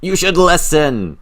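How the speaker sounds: high, loud exasperated scolding; frustrated mother. Retold or imitated. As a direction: scold like a fed-up mom. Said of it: "You can hear the frustration".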